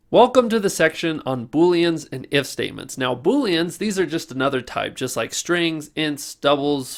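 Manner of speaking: loud, upbeat presenter read, pushing energy; pep the students up like an online course host; bright hyped-up tutorial host; energetic course host. Acted. Upbeat course outro and intro read.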